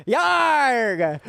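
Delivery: high, hammed-up pirate growl of yarg; playful pirate shout on cue. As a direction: bark it out like a cartoon pirate. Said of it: "you want me to speak like a pirate".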